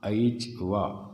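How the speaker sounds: ऐच and वा drawn out slowly apart; slow word-by-word split. Shown as a demonstration. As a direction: separate each part of वृद्धिरादैच slowly. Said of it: "पदच्छेद".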